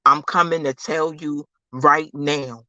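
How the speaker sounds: ordinary talk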